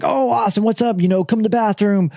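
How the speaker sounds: high-pitched, breezy mock greeting; imitated friendly girls. Party girls inviting him in, high-pitched imitation. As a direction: put on two girls' high welcome.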